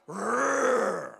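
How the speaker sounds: low growling mock roar; lion roar. Preacher mimics a lion growling outside, low mock roar. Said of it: "biglang merong umatungal".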